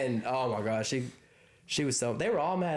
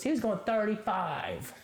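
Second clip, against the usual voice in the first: higher, slow quavery voice; mock old lady; 8 semitones higher than usual. Old lady bystander defending the driver, lightly mimicked. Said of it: "some old lady goes".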